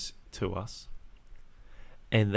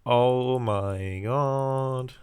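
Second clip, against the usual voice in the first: slow drawn-out disbelief; stunned; 3 semitones higher, much slower than usual. Retold or imitated. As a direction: stretch it out, stunned. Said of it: "I was just".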